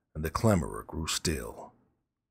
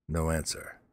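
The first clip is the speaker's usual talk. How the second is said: plain reading voice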